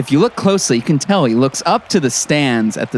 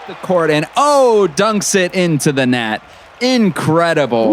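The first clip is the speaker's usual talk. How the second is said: excited call; 6 semitones higher than usual. Acted. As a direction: call the dunk like the crowd just erupted. Excited mock play-by-play call.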